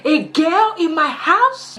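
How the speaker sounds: high, loud, slow disbelief; outraged mum. Retold or imitated. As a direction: imitate a mum's scandalised question.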